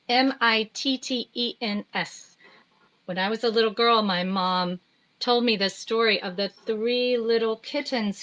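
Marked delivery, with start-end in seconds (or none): 0.1-2.4 M I T T E N S each letter separate; spelled out letter by letter. Shown as a demonstration. Teacher spelling the word to children letter by letter. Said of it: "spelling the word".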